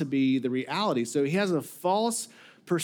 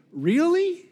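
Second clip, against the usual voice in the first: high-pitched surprised "really?", then settling back; incredulous; 12 semitones higher, 3 dB louder than usual. Acted. Master answering the servant's accusation, incredulous. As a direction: question it in mock disbelief. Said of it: "the master is saying".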